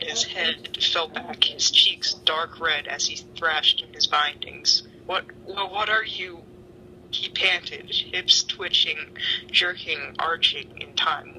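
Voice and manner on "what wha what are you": usual speaking voice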